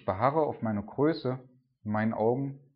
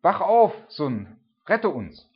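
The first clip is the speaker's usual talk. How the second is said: much higher and louder cry, then slow; desperate shout; 9 semitones higher, 10 dB louder, slower than usual. Retold or imitated. Disciples shouting to sleeping Jesus, desperate loud cry. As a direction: yell him awake, begging for rescue. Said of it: "wieder zu ihm schreien".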